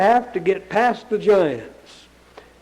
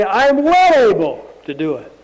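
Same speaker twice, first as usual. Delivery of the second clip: bold declaration; 2 semitones higher, 7 dB louder than usual. Retold or imitated. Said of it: "go along with Caleb and Joshua and say".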